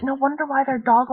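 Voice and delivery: plain reading voice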